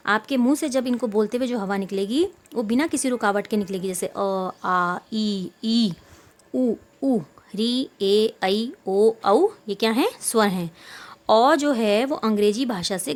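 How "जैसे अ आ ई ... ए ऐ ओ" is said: each vowel sounded out clearly on its own; clear, separate vowel sounds for the class; vowel demonstration. Shown as a demonstration. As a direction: say अ, आ, ई one by one so the air flows freely. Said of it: "जैसे आप इनको बोल के देखेंगे".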